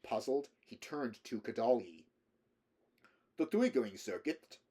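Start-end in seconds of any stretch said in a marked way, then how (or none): 3.4-4.6 put-on higher, cheerful Slavic-accented voice. A narrator's reading. Russian man reveals his trick to Rick, cheerfully. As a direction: explain gleefully with a Slavic accent. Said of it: "Kadalyi said cheerfully".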